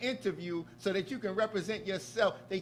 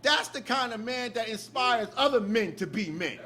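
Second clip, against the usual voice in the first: loud, driving sermon voice; preaching; 6 dB louder than usual. Acted. Minister preaching to the mosque about Farrakhan, loud and rousing. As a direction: build the congregation up, hammering each line.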